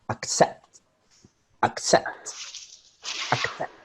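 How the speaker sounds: "accept" with low-volume "ac" and punched "CEPT"; "ac-CEPT", stress on the end. Shown as a demonstration. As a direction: drop the "ac" and push the "CEPT" in "accept". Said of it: "it's a little lower, the volume".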